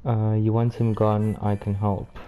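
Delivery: ordinary talk, nothing marked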